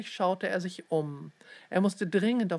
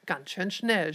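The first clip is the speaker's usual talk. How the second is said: ordinary delivery, nothing marked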